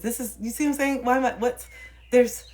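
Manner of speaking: ordinary talk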